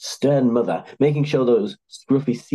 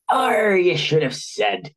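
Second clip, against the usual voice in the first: slow, higher pirate crow; 8 semitones higher, slower than usual. A narrator's reading. Captain cheering up at the mention of cakes, crowing pirate accent. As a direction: stretch out the pirate's delight. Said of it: "he crowed".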